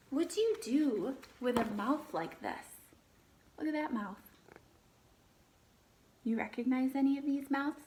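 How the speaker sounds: lively read-aloud, animal names lifted; reading to children. Acted. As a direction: read the book to the class, naming each animal brightly. Teacher reads picture book aloud.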